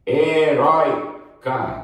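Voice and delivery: "Heróica" high and slow, with a long open ói; open diphthong, drawn out. Shown as a demonstration. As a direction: stretch the ói in "Heróica" before the final ca. ("Heróica" devagar, com ói bem aberto)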